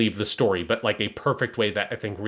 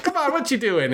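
much higher, a bit louder, teasing; offhand scold; 14 semitones higher, 4 dB louder than usual. Retold or imitated. Suletta scolding the man she kills, high and offhand. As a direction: chide him like it's nothing. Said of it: "Like, basically".

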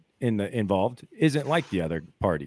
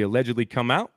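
ordinary delivery

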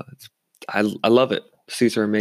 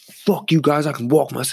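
mock belligerent drunk, higher pitch; retold flipping out; 5 semitones higher than usual. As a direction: imitate a guy lightly flipping out. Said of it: "He just starts flipping out".

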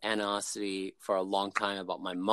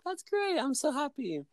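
very high mock mom delight; happy mom. Mom delighted by her son's call, very high. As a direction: gush like a delighted mother.

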